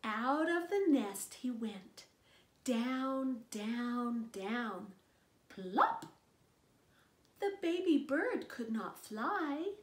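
put-on gentle read-aloud voice for a little child; storybook narration. Narrator reading a picture book to a child, gentle read-aloud. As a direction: read it like bedtime story time.

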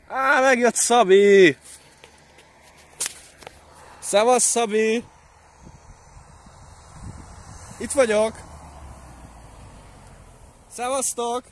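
high, drawn-out greeting call; real excited long-distance holler. Real, not acted. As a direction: hail friends arriving from afar. Calling out to arriving friends.

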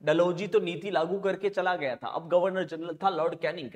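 usual speaking voice

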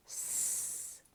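С as a long voiceless hiss, lips spread; hissed С sound. Shown as a demonstration. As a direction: blow air softly over the tongue for a long С. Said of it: "потрібно розтягнути губки в посмішці і подути на язичок". (протяжне глухе С, губи в посмішці)

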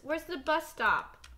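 ordinary delivery

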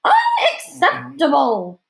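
loud, high, drawn-out exclamation; imperious parting outburst; outraged hostess. A narrator's reading. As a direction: declare it with huge theatrical indignation. Hostess storming off over salad.

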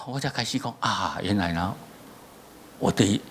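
normal talking voice

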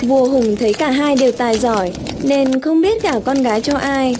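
plain reading voice

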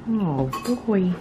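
ordinary talk, nothing marked

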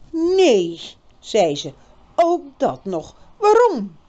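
put-on girl's voice, shocked. A narrator's reading. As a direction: cry it out in disbelief. Birgit shocked.

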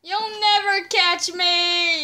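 loud, drawn-out mock getaway cry; playful taunt. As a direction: stretch it out loud and high, taunting the chaser. Taunting in play chase.

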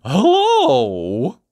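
high, loud, cheery cartoon greeting; Mister Happy. A narrator's reading. Cheerful visitor greets his miserable lookalike, high and loud. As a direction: sing out a big happy hello. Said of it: "said Mister Happy".

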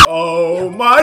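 ordinary talk

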